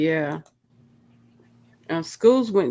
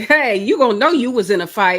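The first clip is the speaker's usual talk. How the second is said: higher, louder imagined comeback; feisty challenge; 4 semitones higher, 6 dB louder, faster than usual. Acted. Host cheering on Granny D's fight, feisty challenge. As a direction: act out a spirited fighting boast. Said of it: "Oh, you said".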